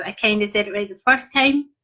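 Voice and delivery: normal talking voice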